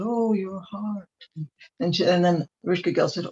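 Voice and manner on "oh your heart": higher, slow, squeaky copycat of her groan; mocking echo. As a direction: parrot her moan back like a tiny fly. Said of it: "the flies sort of mimicked her".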